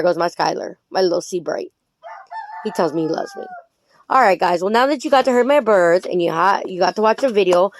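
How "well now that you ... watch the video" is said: ordinary talk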